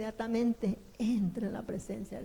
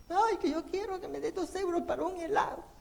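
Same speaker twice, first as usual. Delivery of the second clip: high whiny child, hammed-up; a hint of fake crying, pitched up; mock crying child; 8 semitones higher than usual. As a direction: whine like a kid denied ice cream. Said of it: "el niño empieza a llorar".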